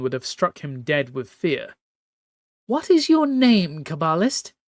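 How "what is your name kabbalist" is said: high regal voice, commanding and cold; imperious empress. Acted. Empress Lawan overruling her nervous captain, haughty high command. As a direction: speak as a haughty sea empress giving orders. Furious empress demands name.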